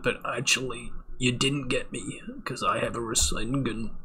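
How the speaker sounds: slow, high, ending in a whisper; smug anime reveal, dropping to whisper; mock anime voice. Mocking anime character retort.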